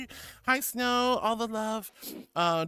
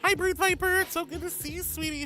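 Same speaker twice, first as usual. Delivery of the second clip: high sweet greeting; 11 semitones higher, 5 dB louder than usual. Real, not acted.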